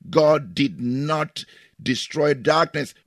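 ordinary talk

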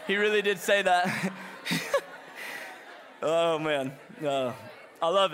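real laughter over the words; laughing through it. Real, not acted. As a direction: say it while cracking up. Laughing after the reveal.

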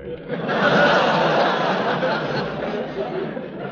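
long scornful chuckle, breathy; mocking laugh. Gildersleeve mocking the charming Calhoun with a laugh. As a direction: snort a derisive laugh.

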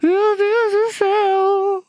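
high, slow horrified cry; shocked. Real, not acted. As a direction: stretch it out in disbelief. Shocked by permanent numbness.

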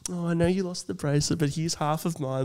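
soft, slowing consolation; imagine breaking it to them gently, hushed; softly acted sympathy; gentle consoling. Imagining the gentle angle for the card.

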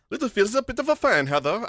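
high mock English accent, royal snobbishness. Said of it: "can sound like royal snobbishness".

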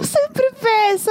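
high, slow, laughing mimicry of her partner; mocking imitation. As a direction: parrot his words back in a teasing squeak. Mocks partner's line, laughing.

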